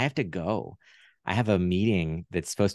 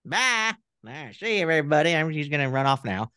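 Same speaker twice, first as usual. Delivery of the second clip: much higher folksy puppet voice greeting the host; Southern puppet character; 8 semitones higher than usual. Pretend. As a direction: play the friendly talk-show puppet. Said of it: "I'll try him on".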